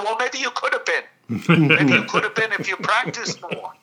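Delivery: lightly voiced teasing foreigner; teasing masseuse. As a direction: masseuse needling her client about practice. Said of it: "And she said".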